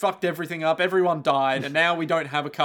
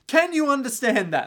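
raised, fed-up demand; exasperated; 9 semitones higher, 4 dB louder than usual. Retold or imitated. Carmilla exasperated.

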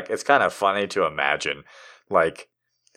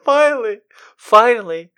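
laughing relief; 13 semitones higher, 7 dB louder than usual. Real, not acted. Laughing at the story's twist.

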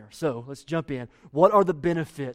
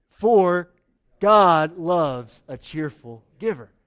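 loud, slow, emphatic unison reading; louder read-along; 6 dB louder, much slower than usual. Shown as a demonstration. Pastor rereads verse with congregation after they were bad, loud and slow. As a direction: lead the room, louder this time. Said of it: "Let's do it again".

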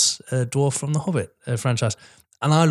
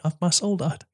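normal talking voice

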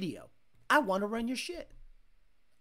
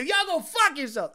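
mock outburst, higher pitched; higher, mildly heated retelling; retold blow-up; 6 semitones higher, 3 dB louder than usual. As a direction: imitate someone who has had enough. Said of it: "by the end of the video".

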